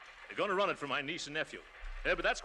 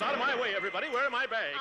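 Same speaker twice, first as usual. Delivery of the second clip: flustered shout; 6 semitones higher, 5 dB louder, faster than usual. Acted. Rushing to catch train.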